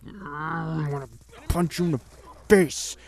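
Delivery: mock angry thought.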